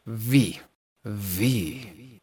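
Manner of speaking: low, soft "Vi, vi"; romantic. Shown as a demonstration. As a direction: let love seep into each "vi". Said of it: "Así, que se sienta el amor".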